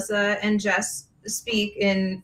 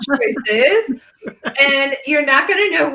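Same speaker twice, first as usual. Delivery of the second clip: louder, laughing echo of Choices; laughing repeat; 4 semitones higher, 5 dB louder, slower than usual. Real, not acted. Guest repeating her favorite word, laughing. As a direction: toss Choices back with a laugh. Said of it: "That's my favorite line, choices".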